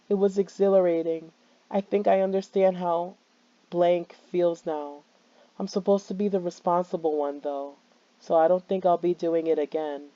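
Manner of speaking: lightly voiced girl's confession; reading the poem. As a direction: read it flat and eerie. Reads a character's poem.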